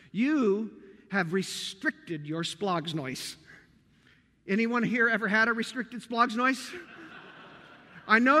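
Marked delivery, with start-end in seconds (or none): 0.1-3.4 slow grave rebuke. Acted. Paul's rebuke quoted slowly.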